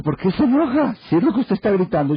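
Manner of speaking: pitched well up, teasing the lady; high mock retort. Husband answers offended lady. (réplica burlona en voz mucho más aguda)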